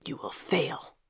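soft, menacing whisper; harsh whisper. A narrator's reading. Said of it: "whispered harshly to Kira".

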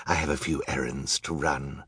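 soft, low villain voice; Olaf. A narrator's reading. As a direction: confide a scheme, low and quiet. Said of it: "Olaf said".

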